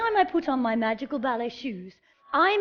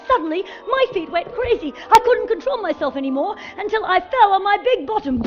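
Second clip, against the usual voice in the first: rising, louder, breathless excitement; excited; 8 semitones higher, 6 dB louder than usual. Acted. Fairy telling children how the curse made her feet go crazy, excited. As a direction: let it run away with you.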